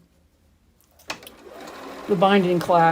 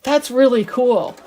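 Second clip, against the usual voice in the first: impressed son-in-law; 6 semitones higher, 7 dB louder than usual. Retold or imitated. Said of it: "he's like".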